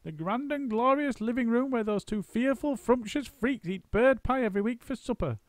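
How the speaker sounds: high, bubbly monkey voice; gleeful monkey mocking his captors; high, gleeful storybook monkey; eager monkey. A narrator's reading. Mugglewump's speech.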